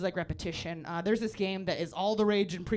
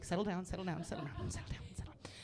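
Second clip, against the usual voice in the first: softer and softer, into a whisper; fading to a whisper; 3 semitones lower, 10 dB quieter, faster than usual. Retold or imitated. Teacher settling the class, fading to a whisper. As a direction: hush them down to nothing. Calming kids to quiet.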